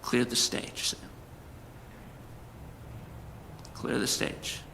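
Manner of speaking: hushed whisper of God; still small voice. Retold or imitated. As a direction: barely breathe it, gently. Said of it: "I hear the still small voice say".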